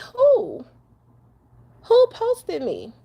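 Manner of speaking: high, slow, disbelieving "who"; retold shock. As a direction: act out your own startled reaction, drawn out. Her retold shocked reaction.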